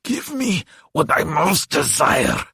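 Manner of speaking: high, drawn-out monster demand; demanding demon. A narrator's reading. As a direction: insist slowly, in a creature's voice. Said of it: "he demanded".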